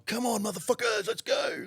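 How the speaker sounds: high, hyped-up call; urgent hollering. Retold or imitated. Marshall jumped in driver's seat, urgent hollering. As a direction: imitate a wired getaway driver rallying everyone.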